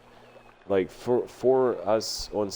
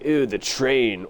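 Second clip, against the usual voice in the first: disgusted commuter; 4 semitones higher, 4 dB louder, slower than usual. Retold or imitated. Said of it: "You're like".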